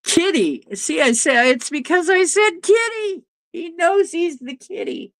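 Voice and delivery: high-pitched cooing to a kitty; pet talk. Real, not acted. Owner explains why the kitty came, high pet-talk voice. Talking to and about the cat.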